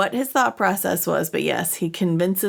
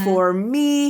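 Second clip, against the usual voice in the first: gleeful; 4 semitones higher, 3 dB louder than usual. Retold or imitated. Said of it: "He is so excited".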